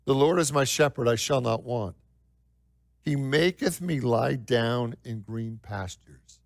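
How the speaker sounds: scripture reading. Acted. Reads Psalm 23.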